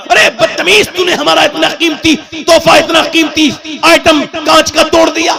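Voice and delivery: high-pitched furious scolding; acted angry mother. Mother scolds child who broke item.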